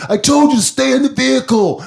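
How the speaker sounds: loud, high forceful order; angry cop. Retold or imitated. Cop grabbing driver's arm, loud angry order. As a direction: snap it while grabbing his arm. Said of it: "grabs it with so much force".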